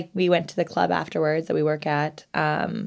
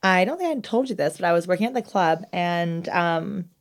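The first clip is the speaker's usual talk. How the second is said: ordinary talk, nothing marked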